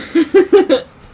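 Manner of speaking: loud high laugh at her own mix-up; burst of laughter. Real, not acted. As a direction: break into a big laugh.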